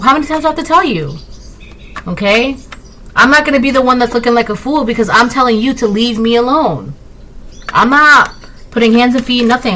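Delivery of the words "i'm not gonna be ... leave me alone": ordinary talk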